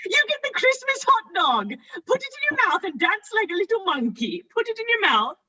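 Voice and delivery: high giddy prize announcement; giddy host. Acted. Host hands the guest the prize, giddy and high. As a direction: gush it out like a giddy party host.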